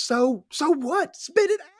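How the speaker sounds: high, slow exasperated outburst; frustrated. Retold or imitated. Patty losing patience with Brian, high and exasperated. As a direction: push him to spit it out, very high. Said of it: "She's so frustrated".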